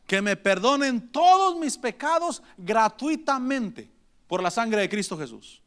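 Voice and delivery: ordinary talk, nothing marked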